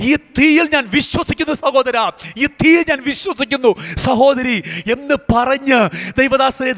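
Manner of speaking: preacher prophesying over the listeners, fervent and high; prophetic preaching. Acted. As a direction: proclaim the promise with rising fervour.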